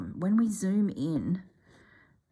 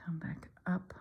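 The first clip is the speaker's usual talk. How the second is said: holding breath; 6 dB quieter than usual. Real, not acted. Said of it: "It's 'cause I'm holding my breath".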